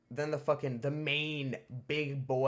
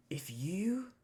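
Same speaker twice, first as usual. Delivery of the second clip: menacing whisper; 7 semitones higher than usual. Retold or imitated. Principal's threat.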